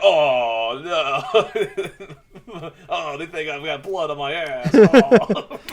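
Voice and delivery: laughing through a high mock quote. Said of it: "And he's like".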